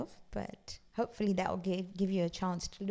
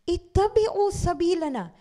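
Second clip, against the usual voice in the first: higher, louder Arabic recitation; loud, higher recited verse; Quran recitation; 9 semitones higher, 8 dB louder, much faster than usual. Acted. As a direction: proclaim the verse.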